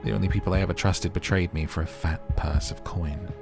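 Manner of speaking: ordinary delivery, nothing marked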